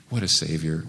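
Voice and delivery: usual speaking voice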